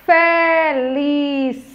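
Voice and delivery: five long, even syllables. Shown as a demonstration. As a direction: stretch out every syllable slowly. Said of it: "Cinco sílabas".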